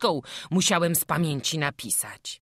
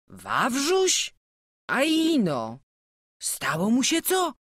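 higher, startled asking; a much higher, anxious string of questions; alarmed question; 10 semitones higher, 3 dB louder than usual. A narrator's reading. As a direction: ask in sudden worry for the boy. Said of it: "spytał Piotr".

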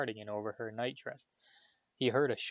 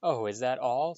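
louder sarcastic retort; angry; 6 dB louder than usual. A narrator's reading. Harry retorts to the elf, angry sarcasm. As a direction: snap back with bitter sarcasm. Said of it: "said Harry angrily".

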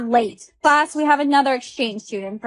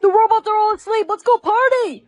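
excited; 10 semitones higher than usual. Acted. Sneaking out excitedly at night.

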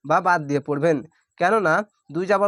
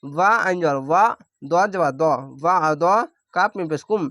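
ordinary talk

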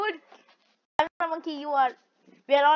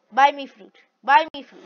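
louder chant of buy me fruit; loud demand; 9 dB louder than usual. Real, not acted. Gamer demanding the loser buy fruit, loudly. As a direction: push the demand hard.

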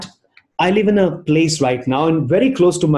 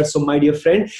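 ordinary talk, nothing marked

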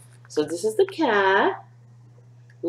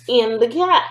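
usual speaking voice

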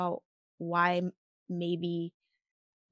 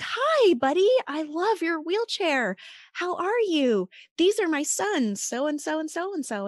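put-on much higher and louder, beaming at a little boy; gushing friendly stranger; 9 semitones higher, 4 dB louder than usual. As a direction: gush over the child, all sunshine. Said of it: "and she was like".

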